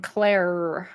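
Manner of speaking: American "Claire" with a pronounced final R; American accent. Shown as a demonstration. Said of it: "in the U S A".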